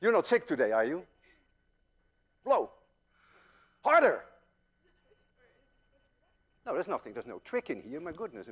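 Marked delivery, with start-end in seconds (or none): 2.4-4.2 urging shout. Real, not acted. Coaching student to blow.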